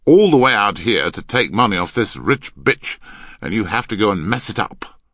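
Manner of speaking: loud, scornful scolding; angry rebuke. Acted. Man berating the girl for spoiling the swindle, angry. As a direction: berate the partner forcefully.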